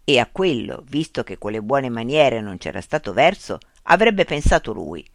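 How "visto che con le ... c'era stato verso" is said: ordinary delivery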